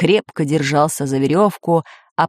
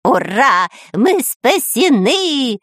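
drawn-out, higher-pitched cheer; joyful shout; 7 semitones higher, 3 dB louder, much slower than usual. A narrator's reading. Spy cheers at being saved, joyful shout. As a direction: cry out in relief, stretching the words. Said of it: "закричал шпион Дырка".

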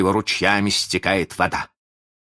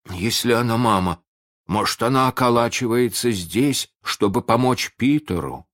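higher, wary questioning; suspicious; 6 semitones higher, 3 dB louder than usual. A narrator's reading. Starkey suspects the bird helps Peter, wary. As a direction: voice a pirate's suspicion. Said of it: "подозрительный Старки спросил".

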